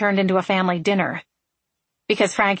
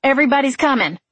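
higher, louder call; shouting; 6 semitones higher, 4 dB louder, faster than usual. A narrator's reading. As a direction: shout it over your shoulder. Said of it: "she shouted as she ran".

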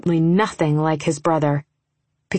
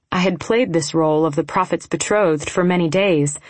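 ordinary delivery, nothing marked

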